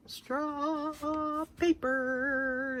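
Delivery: slow, soft, high "Straw paper" stretched out; savoring, drawn out. Real, not acted. Showing viewers new straw paper, "Straw paper" slow, soft and savored.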